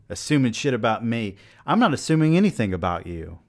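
mock-angry outburst at the listener; mock rant. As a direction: snap back at an imagined heckler, hammed up. Mock argument with imagined listener.

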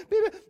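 high, loud electronic beeps; imitated detector beeps. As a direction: sound like a detector going off. Detector going off over nice trips.

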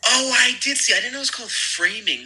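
an excited "oh" of recognition; high, bright realization; sudden recognition. Real, not acted. Realizing which show is meant.

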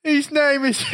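very high imitated sobbing child; mock crying. Crying son answers his dad, imitated high wail. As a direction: mimic a kid wailing out an answer.

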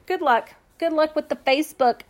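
high, sing-song good-luck wish; mock send-off. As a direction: imitate a host waving off a hater. Acted mock send-off to hater.

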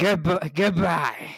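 a light Gilbert Gottfried voice saying goodbye; Gilbert Gottfried impression. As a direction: attempt a gravelly shouty cartoon goodbye, half-hearted. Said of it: "my impression of Gilbert Gottfried".

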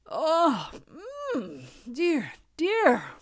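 high waking groan; groggy groan. Acted. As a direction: groan awake with a stretch. Fox wakes, groaning.

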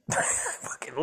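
whispered through a laugh. Real, not acted. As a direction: breathe it out while cracking up. Laughing at his own confession.

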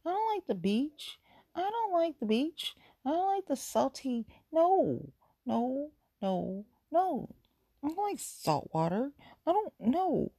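high, whiny pouting complaint; whiny high protest. Retold or imitated. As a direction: protest like a stubborn kid. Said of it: "And I'm like".

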